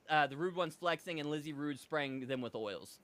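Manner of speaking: normal talking voice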